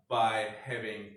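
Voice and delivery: ordinary delivery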